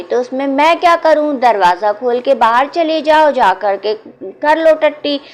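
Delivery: fed up and half asleep; irritated shout. A narrator's reading. Tired Muniya shouts at the pestering child, loud and exasperated. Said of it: "तो वो भाई".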